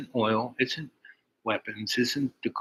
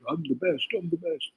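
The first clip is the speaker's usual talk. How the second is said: high mock boast, higher pitch; mock the bragging morons, pitched up; bragging guys; 8 semitones higher, 3 dB quieter than usual. Mocking the boastful computer guys, pitched up high. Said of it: "All the guys are".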